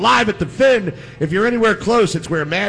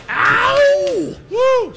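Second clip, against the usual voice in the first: excited whoop. Real, not acted. Hyping the intern on air.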